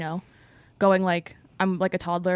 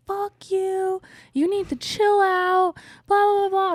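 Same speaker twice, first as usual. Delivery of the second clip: put-on much higher, a bratty defiant girl snapping back; mouthy little girl; 12 semitones higher, slower than usual. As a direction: mimic the tiny drunk girl mouthing off, pitched way up. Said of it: "with her full chest".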